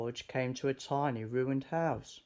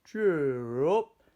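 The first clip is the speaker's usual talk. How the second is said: hearty cheer; 3 semitones higher, 8 dB louder than usual. Acted. George comforts the goat.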